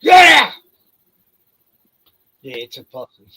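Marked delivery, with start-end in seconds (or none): 0.0-0.5 loud, high shout at the dog; yelling at the dog. Real, not acted. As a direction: bark the order across the yard. Yelling at dog chasing possum.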